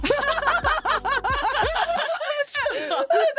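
long squealing laugh, high up. Real, not acted. Host laughing at her own cheeky oil joke, in a long high laugh. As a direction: lose it laughing.

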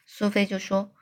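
ordinary delivery

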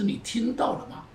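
normal talking voice

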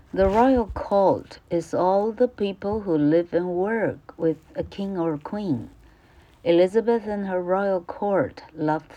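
careful, clear classroom reading; reading the passage aloud to students; textbook read-aloud. Acted. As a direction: read it out for the pupils. Teacher reads English textbook.

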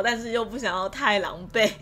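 usual speaking voice

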